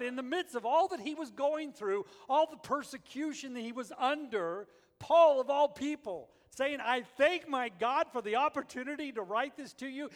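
loud, high preaching voice; preaching at full force. Acted.

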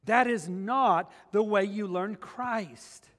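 preacher reading Ephesians aloud; scripture reading. Acted. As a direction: proclaim the verses slowly and with weight.

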